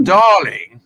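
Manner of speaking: loud, drawn-out "Darling"; mock-affected darling. Ian's not here so she can go, mock-affected. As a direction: sing out the endearment playfully. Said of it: "so I can go".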